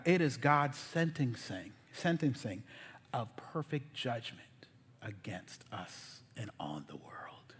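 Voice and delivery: slow, low, fading almost to a whisper; hushed solemnity. Real, not acted. Preacher pronouncing God's judgment on us, hushed and solemn.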